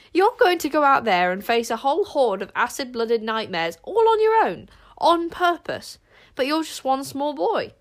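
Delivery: climbing disbelief, loud and high on "all on your own"; horrified friend spelling out the danger; aghast. A narrator's reading. Bodkin aghast at Zar's plan.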